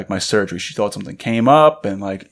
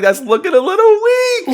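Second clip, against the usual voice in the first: mock high voice; 4 dB louder than usual. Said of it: "I was like".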